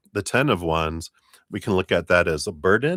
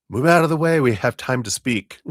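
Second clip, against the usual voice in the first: higher, louder, pushy spirit voices; eager ancestors; 6 semitones higher, 5 dB louder than usual. Retold or imitated. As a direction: ancestors elbowing in to talk. Ancestors pushing forward to speak.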